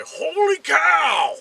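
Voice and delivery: high, drawn-out baseball announcer shout; Cubs announcer imitation. Preacher imitating a famous Cubs announcer, drawn-out shout. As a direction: belt it out like an excited ballpark announcer. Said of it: "famous Cubs announcer that used to say".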